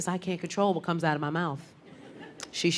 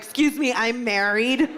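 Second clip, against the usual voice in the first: loud, higher, bright announcing of marriage; eager announcement; 4 semitones higher, 5 dB louder than usual. Pretend. Woman opening every chat with her marriage, loud and eager. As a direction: blurt it out like big news.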